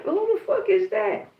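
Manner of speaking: high-pitched, drawn-out disbelief; slow, high incredulous question; baffled, retold. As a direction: act out puzzlement at a far-off caller. Puzzled reply to distant yelling, retold.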